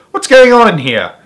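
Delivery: loud demand. A narrator's reading. Father demanding.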